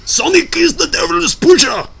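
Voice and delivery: high, loud hammed-up shout at the dev; mock outraged shout. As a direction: bellow it like a mad preacher. Ranting at the game dev.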